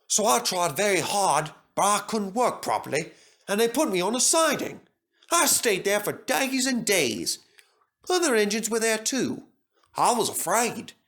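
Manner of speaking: lightly voiced friendly engine; Stepney voice. A narrator's reading. Stepney character voice.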